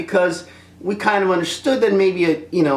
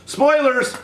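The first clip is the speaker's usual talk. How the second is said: mock shout; 12 semitones higher than usual. Shouted announcement.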